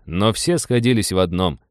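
ordinary delivery, nothing marked

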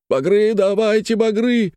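high, anguished moan; high-pitched groaning cry. A narrator's reading. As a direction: wail the plea in despair. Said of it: "простонал старик ему в ответ".

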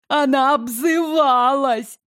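high, put-on sobbing complaint; theatrical sobbing. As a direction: sob it like a hammy liar. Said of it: "рыдала лгунья, театрально заламывая руки".

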